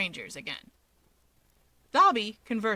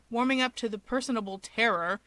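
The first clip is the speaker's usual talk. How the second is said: ordinary delivery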